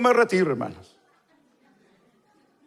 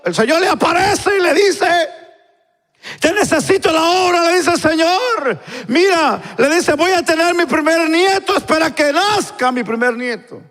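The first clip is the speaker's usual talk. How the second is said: high, insistent preaching; impassioned preaching; 9 semitones higher than usual. Acted. Preacher voices a man asking God to await his grandchild, impassioned. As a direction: build the tale at a high, urgent pitch.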